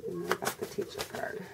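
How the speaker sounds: soft whisper, asking the deck; whispered question to the cards. Real, not acted. Reader whispering her question to the tarot deck, hushed whisper.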